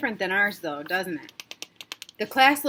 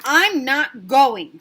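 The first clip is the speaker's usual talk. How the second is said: put-on slow, loud child's refusal; stubborn child; 3 semitones higher, 9 dB louder, much slower than usual. Sarah refusing her dad from under the covers, slow and stubborn. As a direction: dig in your heels.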